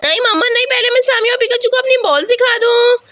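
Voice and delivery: put-on high, excited child voice; high-pitched childish eagerness; eager little girl. Voices insistent child Aisha.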